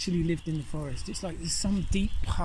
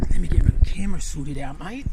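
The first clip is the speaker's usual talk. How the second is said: a light put-on Australian accent, louder; Aussie twang on "mate", louder than his own voice; 9 dB louder than usual. Fixing his camera, slipping into a mock Australian accent. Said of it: "now I'm doing the Australian accent".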